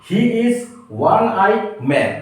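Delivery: one eye man drawn out very slowly; slow example read. Shown as a demonstration. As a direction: dictate it word by word. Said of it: "next প্রশ্নটি দেখুন". (ধীরে ধীরে বাক্য পড়া)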